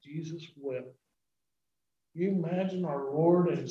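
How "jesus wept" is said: "Jesus wept." breathed almost silently, much softer; hushed repeat. Shown as a demonstration. Preacher repeats the verse to the congregation, hushed near-whisper. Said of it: "it says".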